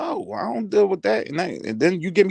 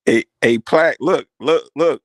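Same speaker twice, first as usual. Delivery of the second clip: loud, urgent call to a co-host; insistent raised-voice call; calling for attention; 3 semitones lower, 7 dB louder, slower than usual. Real, not acted. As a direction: get his attention right now. Calling co-host to see donation.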